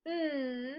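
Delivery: n said as its sound, a soft nnn; letter sound. Shown as a demonstration. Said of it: "the consonant N at the end of den".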